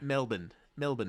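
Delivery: Melbourne as locals say it, MEL-bun; Australian MEL-bun, second vowel swallowed; local pronunciation. Shown as a demonstration. Showing co-host how Australians say it, local pronunciation. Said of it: "Say Melbourne, how they do there".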